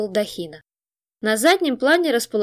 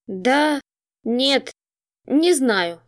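demoralized bleating; 3 semitones higher, much slower than usual. A narrator's reading. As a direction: stammer it out, utterly cowed. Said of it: "проблеял окончательно деморализованный демон".

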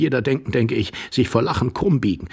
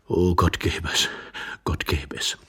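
low murmur fading into a whisper; low, secretive, hopeful sigh; hushed fervent wish; 10 semitones lower, 4 dB quieter than usual. Acted. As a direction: breathe it like a desperate little prayer. Iwan prays under the bed.

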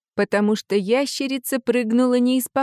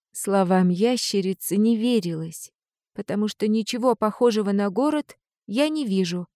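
ordinary delivery, nothing marked

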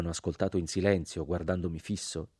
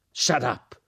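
a sharp, loud, higher yell; shouted; 6 semitones higher, 6 dB louder than usual. Acted. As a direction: bark him into silence. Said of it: "gridò il sergente".

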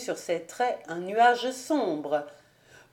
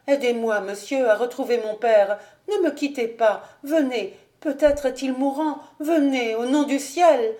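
urgent, louder pleading for her father; frightened plea; 4 semitones higher, 4 dB louder than usual. Acted. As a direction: beg him to come, fearing the worst. Lucie fears father is dying.

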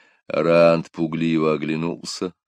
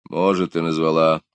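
gruff grumble; 4 dB louder than usual. A narrator's reading. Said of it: "буркнул волкодав".

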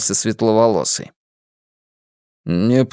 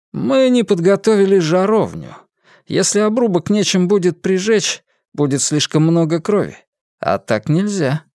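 dispassionate; 7 semitones higher than usual. Acted. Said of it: "бесстрастно произнёс он".

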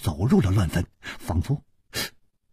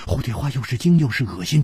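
usual speaking voice

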